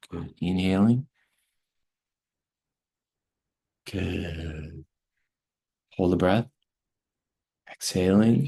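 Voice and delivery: calm teacher guiding a breathing exercise; guided breathwork. Acted. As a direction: lead them gently through each breath.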